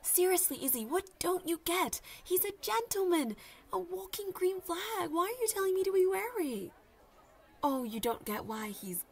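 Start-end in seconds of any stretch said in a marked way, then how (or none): 0.0-6.7 higher and louder, exasperated; exasperated high protest; defensive insistence. Acted. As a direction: defend him hotly to your friend. Arguing with skeptical friend.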